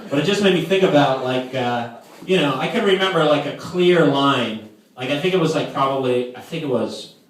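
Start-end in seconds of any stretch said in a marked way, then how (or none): none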